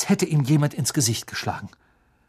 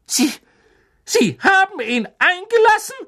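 shrill, much higher shriek; 7 dB louder than usual. A narrator's reading. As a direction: shriek it in disbelief. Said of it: "kreischte Professor McGonagall".

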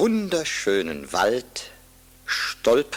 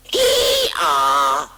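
loud braying Ia like a donkey; hee-haw donkey cry; 3 semitones higher, 6 dB louder than usual. A narrator's reading. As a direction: bray it out like a donkey. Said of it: "schrie wie ein Esel".

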